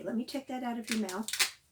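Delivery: ordinary delivery, nothing marked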